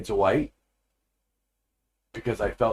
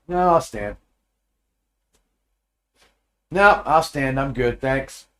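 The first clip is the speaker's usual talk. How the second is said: raised, higher and defiant; stubborn refusal; 5 semitones higher, 6 dB louder, slower than usual. Retold or imitated. Husband refusing his wife's order to sit, raised and defiant. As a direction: dig in and refuse.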